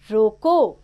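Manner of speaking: रोको loud on its own, then a stop; pause after रोको. Shown as a demonstration. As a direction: say रोको firmly and halt at the comma. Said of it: "एक अल्प विराम लगा है".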